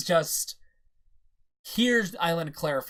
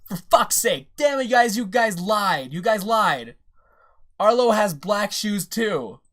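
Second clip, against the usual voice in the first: loud, higher exasperated outburst; real irritation, raised voice; frustrated rant; 5 semitones higher, 7 dB louder than usual. Real, not acted. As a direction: let the annoyance boil over at the viewers. Angry rant at lying commenters.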